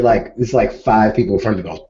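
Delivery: ordinary talk, nothing marked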